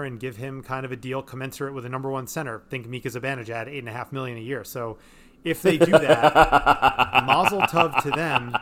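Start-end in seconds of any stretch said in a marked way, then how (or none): 5.4-8.6 loud laughing mazel tov. Real, not acted. Laughing through sarcastic praise.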